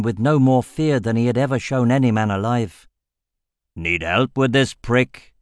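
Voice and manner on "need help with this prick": slow, rough warrior growl; gruff growl. Acted. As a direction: growl it like a battered fighter. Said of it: "he growled".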